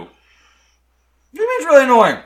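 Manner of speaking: talking while chewing gum. Real, not acted. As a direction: mouth full of gum. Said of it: "Hearing you attempt to chew gum".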